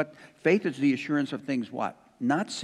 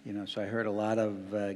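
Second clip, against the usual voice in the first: ordinary talk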